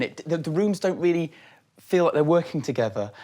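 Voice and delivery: usual speaking voice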